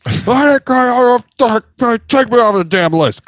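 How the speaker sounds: high, loud, slow mock screech; mock screaming caller. Furious caller demanding removal, high loud mock screech. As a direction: drag out an enraged yell. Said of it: "screaming into the phone".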